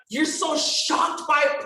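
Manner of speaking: normal talking voice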